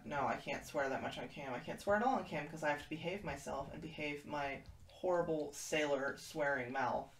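normal talking voice

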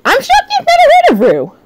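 high, quick burst of surprise; shocked. Real, not acted. Streamer to chat about Rue, shocked. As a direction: pitch leaps up in disbelief.

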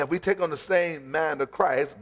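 usual speaking voice

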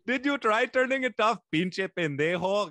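high, mock accent slipping into Spanish; hammed-up Spanish-flavored tech-support voice; Spanish-tinged accent. Host mocking the comic's tech support bit, in a drifting Spanish accent. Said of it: "I heard a little Spanish".

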